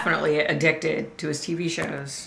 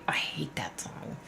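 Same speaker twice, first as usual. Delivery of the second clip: whispering; 4 semitones lower, 13 dB quieter than usual. Real, not acted. Aside about Baby Shark.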